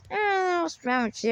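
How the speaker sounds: soft, drawn-out mocking mumble; imitating an annoying mumbler, quietly; mumbling, annoying attitude. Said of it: "it's like a mumbling, annoying attitude".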